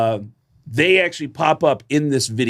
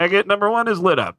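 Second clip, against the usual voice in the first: raised, mocking radio call; mock crew call, a bit higher; mocking stagehand call; 7 semitones higher than usual. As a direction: mimic a crew member sneering over the headset. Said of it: "They go".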